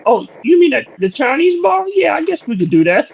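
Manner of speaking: high-pitched, earnest agreement; high voice without any irony; Beetlejuice. Retold or imitated. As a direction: imitate a squeaky voice giving in. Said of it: "he's dead ass serious about it".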